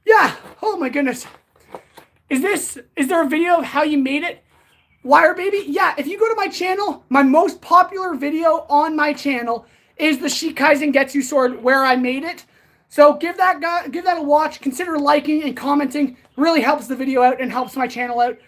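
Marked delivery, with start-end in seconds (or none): none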